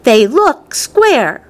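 high, very slow and deliberate; insistent contradiction. A narrator's reading. Veruca corrects Wonka about the candies, insistent. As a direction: spell it out word by word. Spoilt girl argues.

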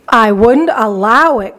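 ordinary delivery, nothing marked

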